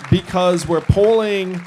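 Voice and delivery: a candidate firing up the room; rousing rally boast. Real, not acted. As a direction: sell it to the crowd. Rally boast building to applause.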